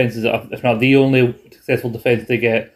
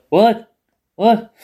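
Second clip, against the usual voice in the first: higher, puzzled repeats; mock confusion; 9 semitones higher than usual. Talkers faking audio trouble, high and puzzled. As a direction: pretend you can't hear. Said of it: "start talking to each other".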